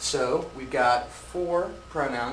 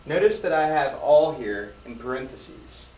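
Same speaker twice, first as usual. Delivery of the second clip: usual speaking voice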